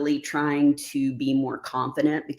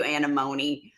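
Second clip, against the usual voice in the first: normal talking voice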